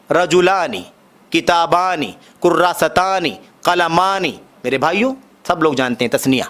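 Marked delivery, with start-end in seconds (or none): none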